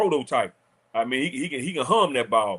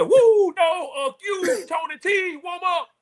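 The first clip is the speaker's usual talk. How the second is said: high, loud mock shout, laughing through it; a hammed-up coach hollering from the sideline; retold coach yelling; 13 semitones higher, 3 dB louder, slower than usual. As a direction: yell it back at him like the coach did, half laughing. Retells the coach yelling back.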